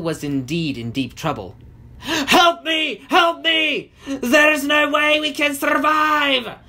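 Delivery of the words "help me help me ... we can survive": high, loud cry for help; wailing storybook wizard shouting for rescue; desperate shouting. A narrator's reading. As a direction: shout it out in panic, pitched way up. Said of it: "the wizard shouted into the wind".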